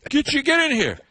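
high-pitched summons; mock coworker calling someone over; imitated New England coworker. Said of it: "one of my New England coworkers".

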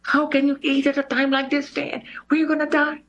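high mock outrage from the lady; shocked supervisor. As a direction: play the horrified supervisor across the table. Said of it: "And the lady's like".

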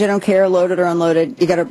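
ordinary talk, nothing marked

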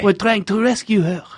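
high, hammed-up French accent. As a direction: keep the mock French hero going. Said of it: "Try to do the impression".

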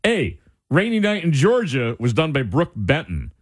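ordinary talk

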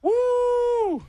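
high, drawn-out whoop; high "woo". Real, not acted. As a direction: let out a long high woo. Raving about the steak method.

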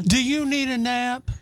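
put-on slow, high baby talk; talking to a toddler. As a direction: coo it like to a toddler. Toddler and drunk joke.

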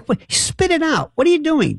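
a pretend demand to spit it out; impatient shout. Host mimicking himself scolding the caller, exasperated. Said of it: "I say".

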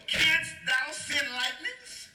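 high, very slow, drawn-out question; God challenging Job. Acted. God asking Job about sending lightning, grandly challenging. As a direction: stretch each word like a grand challenge. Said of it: "He told Job".

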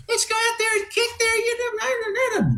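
very high coach pep-talk impression; Barry's high-pitched voice. Radio host mimicking the coach's eagerness, high-pitched voice. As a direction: squeak out a mock rallying cry. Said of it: "you could just hear that high-pitched voice".

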